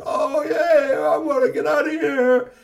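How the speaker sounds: high, loud joyful robot voice; cheering robots. Acted.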